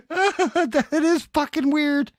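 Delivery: high, slow words through laughter; laughing hard. Real, not acted. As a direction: still cracking up as you speak. Cracking up at the mishap.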